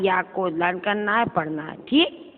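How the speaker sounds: ordinary delivery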